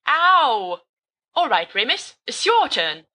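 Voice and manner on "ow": a sharp high yelp of pain. Acted. Friend gets whacked, yelps in sharp pain. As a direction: yelp as if someone just hit you. Yelp after being hit.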